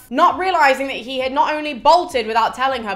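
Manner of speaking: ordinary delivery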